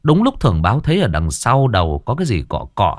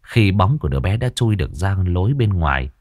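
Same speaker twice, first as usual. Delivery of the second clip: ordinary delivery, nothing marked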